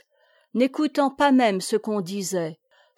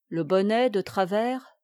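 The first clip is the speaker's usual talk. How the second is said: ordinary delivery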